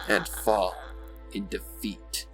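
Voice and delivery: ordinary delivery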